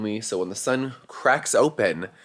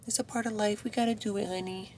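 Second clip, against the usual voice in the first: reassuring pet talk; 11 semitones higher, 9 dB quieter than usual. Real, not acted. Owner comforting nervous dog before surgery, soft and high. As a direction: soothe the dog in a small high voice.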